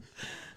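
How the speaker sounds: winded, heaving exhale; heavy breathing. Acted. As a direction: pant heavily like a gorilla after a sprint. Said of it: "You're just heavy breathing like a gorilla".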